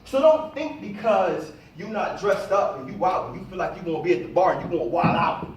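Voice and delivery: ordinary talk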